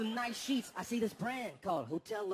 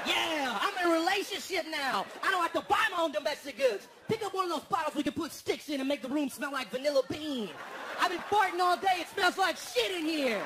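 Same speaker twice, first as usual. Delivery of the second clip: put-on smug boyfriend; 5 semitones higher, 5 dB louder than usual. Imagined boyfriend boasting.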